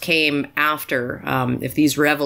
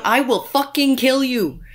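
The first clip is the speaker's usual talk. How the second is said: mock threat; 8 semitones higher than usual. Mock threat to her dog.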